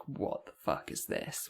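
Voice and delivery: hiss-free whispered mock confrontation; retold hushed confrontation. Classmate confronting her in a corridor, retold in a whisper.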